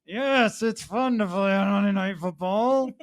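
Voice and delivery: put-on forced answer; mock agreement. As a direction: act out an unconvinced yes. Voicing Cousins's forced answer.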